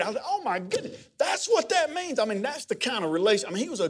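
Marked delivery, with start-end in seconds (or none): 0.3-2.2 high, delighted 'oh my goodness'; retelling the moment the doctrine finally made sense, high delighted surprise; mock amazed realization. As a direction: act out the lightbulb moment, pitch jumping up.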